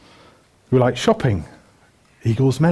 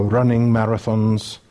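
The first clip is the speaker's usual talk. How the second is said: lightly put-on Welsh accent; 2 semitones lower than usual. Preacher listing fitness fads to the congregation, light Welsh accent. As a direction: slip into a Welsh lilt for a moment. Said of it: "with a Welsh accent again".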